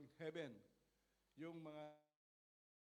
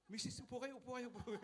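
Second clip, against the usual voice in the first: eager offer; 6 semitones higher than usual. Retold or imitated. Offering seat to pregnant woman.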